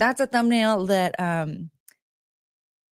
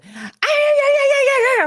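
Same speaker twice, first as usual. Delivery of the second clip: far higher, louder frantic yapping yeahs; dog straining to get back to her boyfriend, shrill frantic yeahs; excited dog voice; 6 dB louder, faster than usual. Retold or imitated. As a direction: squeal like a dog who wants to stay. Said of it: "And then she would".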